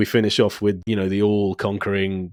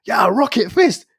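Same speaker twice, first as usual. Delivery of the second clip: mock cheer; 12 semitones higher, 4 dB louder, slower than usual. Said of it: "he goes".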